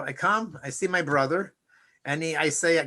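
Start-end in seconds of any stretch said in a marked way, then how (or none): none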